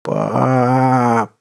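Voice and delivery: put-on drawn-out robotic child cry; a broken doll calling; mechanical voice. A narrator's reading. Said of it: "Полумеханический голос".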